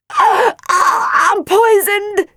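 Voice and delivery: high, loud, hammed-up cry of the poisoned. A narrator's reading. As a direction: cry out in theatrical agony. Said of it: "she cried".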